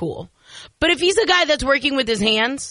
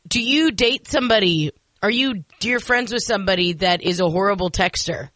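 ordinary delivery

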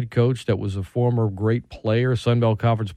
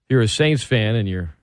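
ordinary talk

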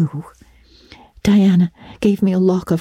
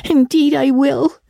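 put-on high-pitched, tearful little girl; sobbing girl; 11 semitones higher than usual. A narrator's reading. Diana promises to remember Anne, tearful sobbing. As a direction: promise it through acted sobs. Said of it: "sobbed Diana".

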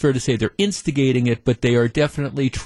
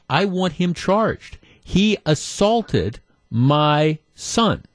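slow, higher, word-by-word mock accusation; mock indignant mother; 5 semitones higher, slower than usual. Mother demanding that cops charge the coach, mock indignation. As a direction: play up the mother's outrage, slowly and deliberately.

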